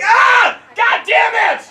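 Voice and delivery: exasperated dad shout; mock yell. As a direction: blow up at the kid, loud. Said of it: "I'll just be like".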